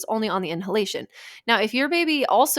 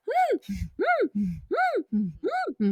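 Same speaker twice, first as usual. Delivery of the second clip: high, squeaky little inhaled hums; short rhythmic squeaks, like a newborn's noisy breathing; imitated stridor; 11 semitones higher, 4 dB quieter, much slower than usual. Mom demonstrating a baby's breathing sound, imitated high-pitched stridor. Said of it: "So they're like".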